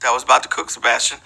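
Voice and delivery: normal talking voice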